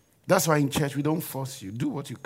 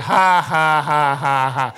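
loud, steady mock guffaw; demonstrated forced laugh; 6 dB louder, much slower than usual. Shown as a demonstration. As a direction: answer with a deliberate belly laugh. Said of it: "So now start it. Learn it".

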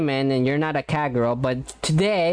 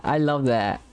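ordinary talk

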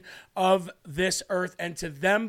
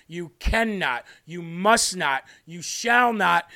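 louder each phrase, ending slow and forceful; building to a shout; 4 dB louder than usual. Acted. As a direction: build it up for the ones in the back. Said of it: "for those in the back who are hard of hearing".

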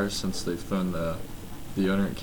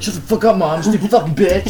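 high, loud imitation of an angry kid; mock bratty yell; 9 semitones higher, 8 dB louder than usual. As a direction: yell back at mom. Imagined kid yelling back at mom.

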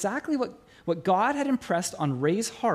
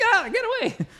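exasperated; 14 semitones higher than usual. Retold or imitated. Ray shooing voices away.

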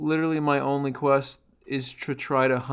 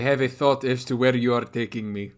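husky Russian man voice; faster than usual. Acted. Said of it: "I sound like a husky Russian man".

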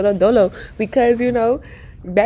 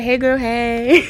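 slow greeting; 3 semitones higher, much slower than usual. Shown as a demonstration. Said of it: "usually, I'd be like".

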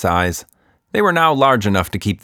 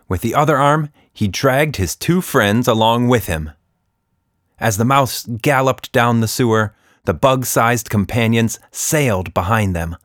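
plain reading voice

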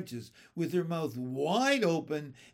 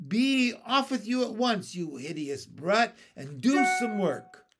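higher, louder scolding aunt; angry aunt; 5 semitones higher, 5 dB louder than usual. Acted. Aunt scolding James back to the wood pile, loud and angry. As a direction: drive the brat off with a scold.

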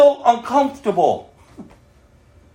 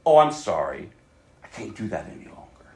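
much softer and lower, gentle apology; humble sorry; 13 semitones lower, 6 dB quieter than usual. Shown as a demonstration. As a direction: drop to a soft humble confession. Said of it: "No, no".

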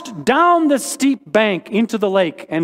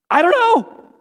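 very high, slow, mock-helpless "I don't know"; squeaky comic "I don't know"; 11 semitones higher, 3 dB louder than usual. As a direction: squeak it out like a baffled shrug. Comic refrain answering hard questions.

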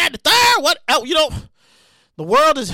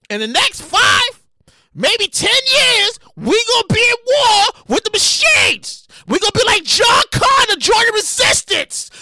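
excited shouting; 7 semitones higher, slower than usual. Real, not acted. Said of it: "Damn, I'm all shouting and stuff".

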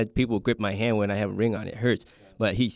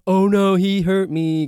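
loud, high, hammed-up cry of hurt; mock fake injury; 9 semitones higher, 5 dB louder, slower than usual. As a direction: play the victim like a flopper fishing for a foul. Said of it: "they're gonna flop for a foul".

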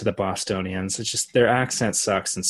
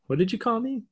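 high, mock-offended Bigfoot; 12 semitones higher, 4 dB quieter than usual. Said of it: "he's like".

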